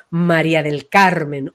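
María del Carmen said low and slow; grand full name. Shown as a demonstration. Voice coach savouring the full name, low and slow. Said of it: "ocupar un lugar grande".

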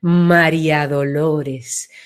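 "María Dolores" said low and slow, spread wide; broad deep name. Shown as a demonstration. Teacher widening a name for her listener, low and slow. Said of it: "se ensancha".

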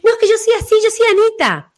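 high, insistent protest voice; mock resistant client. Imitating a client insisting who she is. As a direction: mimic someone clinging to their name. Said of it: "La gente dice".

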